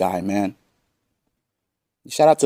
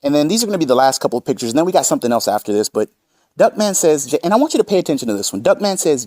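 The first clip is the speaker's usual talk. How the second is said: usual speaking voice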